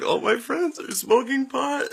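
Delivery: high, put-on voice of a younger self; mock distraught confession. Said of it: "I'm like".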